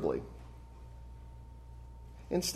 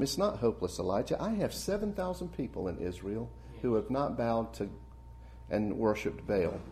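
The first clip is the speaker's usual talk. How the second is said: softer, gentle and hushed; small still voice; 2 semitones lower, 7 dB quieter than usual. Retold or imitated. God reassuring the discouraged Elijah, soft and still. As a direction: speak it quietly, like a calm inner voice. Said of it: "in a small, still voice".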